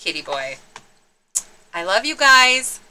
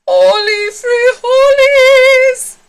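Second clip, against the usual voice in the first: very high, loud, slow war whoop; hammed-up heroic shout; battle cry; 11 dB louder, much slower than usual. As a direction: yell it as you charge. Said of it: "cried Scipito".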